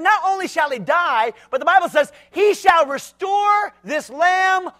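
slow emphatic shout; stern sentence. Retold or imitated. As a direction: hand down the punishment. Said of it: "He said".

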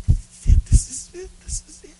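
high, breathless excited squeak; excited boy. Acted. Beggar boy as the governor stops the procession, excited. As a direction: gasp it out in rising excitement. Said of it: "the little boy said".

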